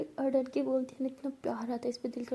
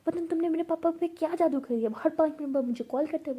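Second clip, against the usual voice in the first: higher, louder grumbling; exasperated complaint; 5 semitones higher, 5 dB louder than usual. Acted. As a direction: complain in a raised voice. झगड़े में शिकायत. (झुंझलाहट भरी शिकायत)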